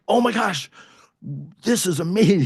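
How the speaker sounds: high, bright burst of retold delight; retold excitement. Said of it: "He was super excited".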